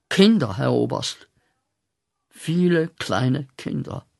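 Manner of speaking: ordinary talk, nothing marked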